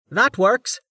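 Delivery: high-pitched character voice; agreeable scholar. A narrator's reading. Roma's put-on character voice.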